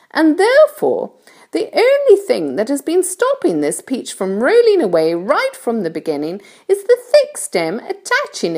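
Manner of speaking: high-pitched storybook ladybird voice; kindly ladybird. A narrator's reading. Ladybird explains things to James, kindly high voice. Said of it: "the ladybird went on".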